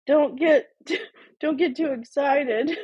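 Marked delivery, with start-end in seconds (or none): none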